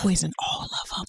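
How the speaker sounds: quick hushed whisper. Real, not acted. To her co-host about the men, a quick whisper. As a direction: whisper it fast, half joking.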